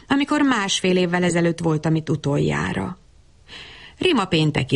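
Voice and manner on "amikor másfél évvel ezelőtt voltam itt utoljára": usual speaking voice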